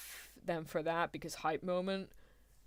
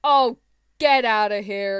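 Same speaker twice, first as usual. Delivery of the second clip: much louder, indignant outburst; loud disbelief; 2 semitones higher, 12 dB louder than usual. Real, not acted. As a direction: burst out, incredulous at the unfairness. Outraged by Cater's special treatment.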